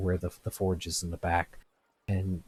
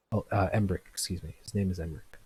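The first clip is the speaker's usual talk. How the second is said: usual speaking voice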